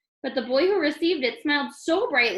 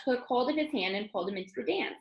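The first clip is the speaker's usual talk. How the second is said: usual speaking voice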